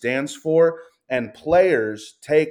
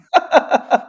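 a big burst of laughter, much higher and louder; 9 dB louder than usual. Real, not acted. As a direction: crack up at yourself. Laughs at own rambling.

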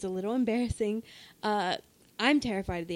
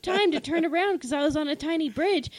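loud, high, breathless rush of story; frantic retelling; 6 semitones higher, 5 dB louder than usual. Real, not acted. Player recounting alligator attack to friends, frantic. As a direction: tell it at a panicked pitch.

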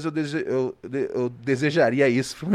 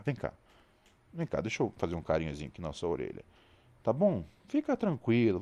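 soft, soothing pet talk; calm the dog gently; tender; 3 semitones lower, 4 dB quieter than usual. Real, not acted.

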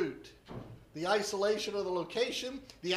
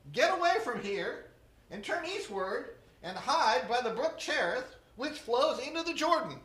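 scripture reading; 3 semitones higher than usual. Acted. Scripture reading, divine command.

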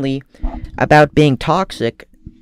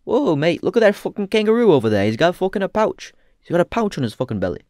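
hammed-up, higher-pitched Australian bloke; Australian accent; 6 semitones higher than usual. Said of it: "when you act as an Australian, you go".

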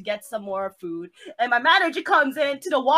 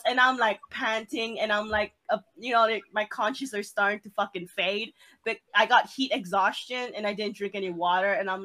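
ordinary talk

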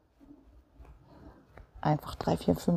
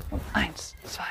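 whispered count; 2 semitones higher, 4 dB quieter than usual. Acted. Coach counting reps in a whisper.

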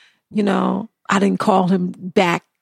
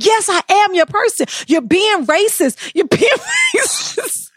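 put-on drunk indignant protest; 10 semitones higher than usual. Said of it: "And I was like".

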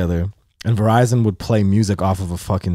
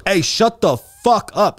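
high, drawn-out mock order to shut up; slow, raised-pitch exasperated command; angry order; 8 semitones higher, slower than usual. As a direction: imitate a fed-up robber, stretching the words. Robber silencing the crying coworker.

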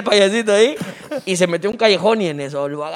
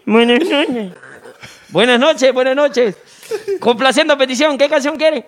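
a hint of an upbeat radio host, slightly higher; 6 semitones higher than usual. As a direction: greet the caller brightly. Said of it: "están locutores". (locutor animado, leve)

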